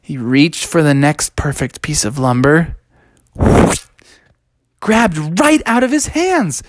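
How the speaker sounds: ordinary delivery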